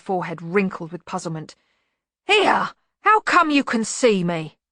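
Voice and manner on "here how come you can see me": loud, high protest, slowing down; high, loud monster's complaint; startled bogeyman. A narrator's reading. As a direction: burst out indignantly.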